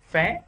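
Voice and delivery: "phé" with a sharp rise; stressed rising tone. Shown as a demonstration. Teacher showing the stressed syllable, "phé" rising sharply. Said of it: "đọc thêm dấu sắc".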